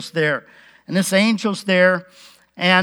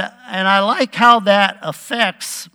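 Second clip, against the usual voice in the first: usual speaking voice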